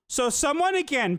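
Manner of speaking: ordinary delivery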